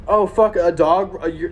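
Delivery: ordinary talk, nothing marked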